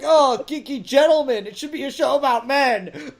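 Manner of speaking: loud, high whiny protest of an offended fan; hammed-up indignant complainer, pushed up high; mock outrage. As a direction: blurt it out like an aggrieved viewer. Mocking complainers' imagined protest.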